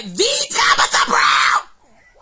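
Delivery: slow, loud fan announcement; drawn-out excited holler; starstruck shout. Real, not acted. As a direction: belt out the star's name, stretched. Gushing over her idol's name.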